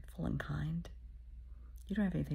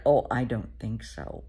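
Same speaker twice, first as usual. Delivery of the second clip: skeptical parent; 6 semitones lower, 8 dB louder than usual. Retold or imitated. Parent judging the date.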